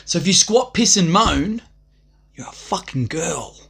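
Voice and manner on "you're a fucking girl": slow unvoiced whispered insult. Real, not acted. Whispering the insult about [name]. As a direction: hiss the insult softly.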